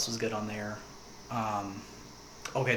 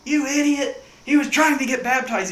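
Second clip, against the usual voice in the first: high, loud mock furious scolding; angry yelling; 13 semitones higher, 13 dB louder than usual. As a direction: yell at him for ruining it. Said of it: "and they're like".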